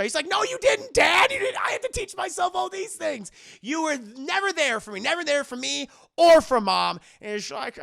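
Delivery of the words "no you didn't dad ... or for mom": high, loud hurt shout; yelling at dad. Retold or imitated. Son yelling at father over childhood neglect, high and loud. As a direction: shout it out, years of resentment.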